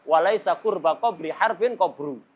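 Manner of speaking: tricky verse run together in one flow; strung-together verse. Shown as a demonstration. Teacher showing it gets hard once joined, strung together. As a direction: link all the words without pausing. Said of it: "Ini setelah dirangkai susah".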